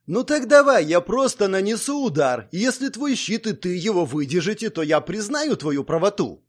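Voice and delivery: high, loud challenge, easing towards the end; worked-up and insistent; heated challenge. A narrator's reading. Irritated challenge to prove shield.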